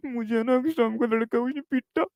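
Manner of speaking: put-on high, soft, tearful child's whine; crying child. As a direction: whimper it like a little boy telling on someone. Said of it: "रोता-रोता गया".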